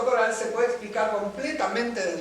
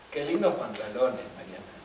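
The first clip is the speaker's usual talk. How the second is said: lower, dry irony with weight on lindos; sarcastic compliment; 8 semitones lower, 3 dB quieter than usual. Shown as a demonstration. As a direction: say it as a mocking jab at Mariana. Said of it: "yo lo puedo cargar con distinta subjetividad".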